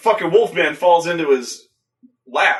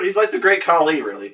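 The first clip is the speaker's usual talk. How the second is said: ordinary talk, nothing marked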